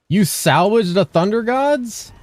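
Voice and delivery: high, slow shocked question; shocked disbelief. Real, not acted. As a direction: stretch it out in disbelief. Shocked reaction to teammate.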